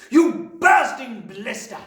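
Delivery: furious, drawn-out high yell; yelling Trunchbull. A narrator's reading. Headmistress yelling at Wilfred, drawn out and furious. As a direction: explode with the insult, slow and loud. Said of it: "yelled the Trunchbull".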